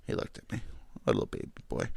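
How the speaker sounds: soft breathy whisper about the dog; hushed, doting whisper; whispered tenderness. Real, not acted. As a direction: whisper it like cooing to a pet.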